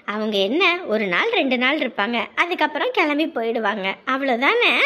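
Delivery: calm her down gently; elder sister comforting the crying girl, reassuring. Acted.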